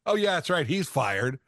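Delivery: ordinary talk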